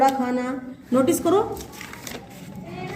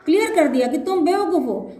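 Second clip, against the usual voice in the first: normal talking voice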